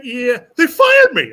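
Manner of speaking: slow, high, loud mock complaint through laughter; laughing retelling. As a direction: act out the punchline while cracking up. Retold punchline amid laughter.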